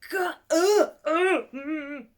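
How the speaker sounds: high, loud, choked gasps; hammed-up horrified sob; mock horror. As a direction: whimper in horrified shock. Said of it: "You go".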